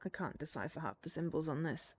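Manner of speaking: ordinary delivery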